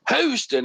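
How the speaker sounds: Houston drawled high like a country Texan; Texas drawl. Shown as a demonstration. Friends greet anything Houston with a drawled Houston. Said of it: "that's how we say it".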